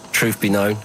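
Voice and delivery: normal talking voice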